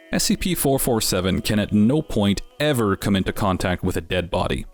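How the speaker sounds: ordinary delivery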